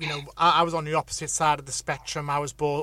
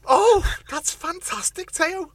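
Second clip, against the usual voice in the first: Liverpool accent; 13 semitones higher, 7 dB louder than usual. Shown as a demonstration. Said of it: "she's bringing out my Liverpool now".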